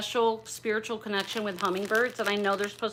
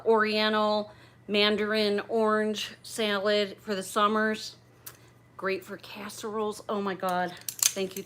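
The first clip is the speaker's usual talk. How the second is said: normal talking voice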